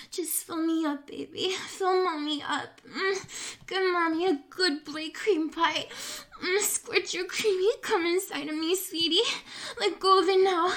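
heated climax urging; breathless urging. Acted. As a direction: push him over the edge, voice climbing. Acted climax, urging, higher louder.

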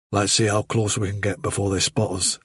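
slightly hushed, low character voice; a low, quiet aside while creeping up; Silver the rabbit. Acted.

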